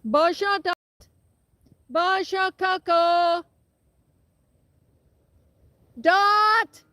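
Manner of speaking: high, loud drawn-out French call; high cow call. Real, not acted. Farmer calling her cows in French across the wind. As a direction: sing it out to the herd. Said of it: "Let's try it again".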